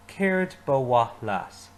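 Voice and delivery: slow, careful model line; teacher modelling slowly; slow model phrase. Shown as a demonstration. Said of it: "Say it with me".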